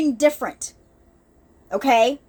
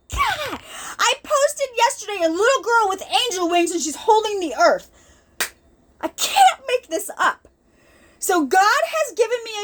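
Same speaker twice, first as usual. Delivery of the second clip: fighting back tears as she tells it; getting emotional; 6 semitones higher than usual. Real, not acted. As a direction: voice catching with real tears, rising higher. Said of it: "I'm sorry if I start to get emotional".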